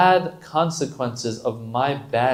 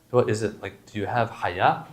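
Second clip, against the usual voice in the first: ordinary talk, nothing marked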